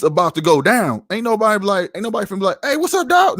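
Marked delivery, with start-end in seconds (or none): 2.6-3.4 high, over-cheery hailing; hammed-up chummy hello, pitched way up; mock friendly greeting. Mocking a greeting nobody would use.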